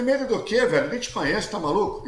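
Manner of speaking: high-pitched indignant comeback; falando alto, indignado. Retold or imitated. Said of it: "eu comecei a falar alto". (retrucando indignado, com voz aguda)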